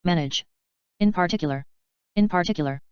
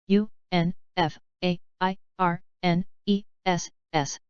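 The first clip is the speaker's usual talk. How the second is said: slow, letter by letter; unhurried classroom spelling. Shown as a demonstration. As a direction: spell each letter out slowly. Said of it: "spelling drill, slow then fast".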